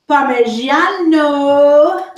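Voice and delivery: "Parmigiano" drawn out slowly and loudly in a hammed-up Italian accent. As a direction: give "Parmigiano" a big, slow Italian flourish. Showy pronunciation of the cheese name.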